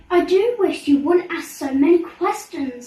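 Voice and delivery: fed up with questions, huffy; impatient princess. A narrator's reading. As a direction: royal exasperation. Mirabelle impatient.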